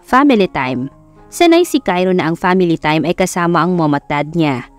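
ordinary delivery, nothing marked

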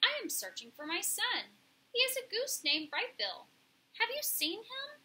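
a hint of an earnest robot asking for help; polite robot mother. A narrator's reading. Voices robot Roz.

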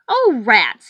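sniveling. A narrator's reading. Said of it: "he sniveled".

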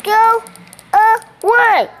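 high, loud frantic yelling; shouting. Acted. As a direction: yell at the monster to leave. Shouting at enemy in game.

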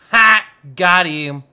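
loud burst of mock triumph; gleeful gotcha. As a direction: crow loudly over a prank that landed. Crowing after a nuts joke.

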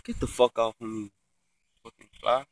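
normal talking voice

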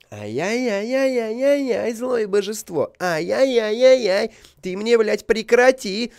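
high, slow, hammed-up wailing; overacted lament at the evil god; mocking wail. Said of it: "Он". (high, slow, hammed-up wailing)

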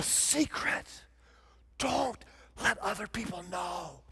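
mock conspiratorial whisper; hushed secret. Urging addicts to keep secrets.

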